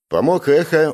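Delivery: ordinary delivery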